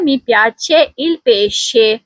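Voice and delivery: "मी piace il pesce" said very slowly, pesce as PEH-sheh; teacher modelling Italian slowly for repetition; slow Italian model sentence. Shown as a demonstration. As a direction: spell each word out slowly for the class. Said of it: "पहला जुमला है".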